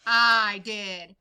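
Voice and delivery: loud low bark of a bully. Acted. Big Bark answering the prairie dogs, loud barked reply. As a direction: bark it down at them. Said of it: "barked a voice from above".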